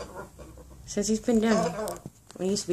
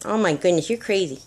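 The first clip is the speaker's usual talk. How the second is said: ordinary talk, nothing marked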